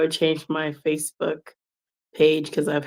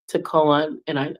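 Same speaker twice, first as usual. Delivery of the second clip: ordinary talk, nothing marked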